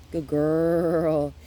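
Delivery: bright loud praise; happy voice. Shown as a demonstration. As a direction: cheer her on, upbeat. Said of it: "Yeah, happy voice".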